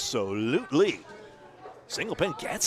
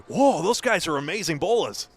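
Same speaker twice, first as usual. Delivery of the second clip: put-on New England accent; 3 semitones higher, 5 dB louder than usual. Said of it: "with a shocking New England accent impersonation".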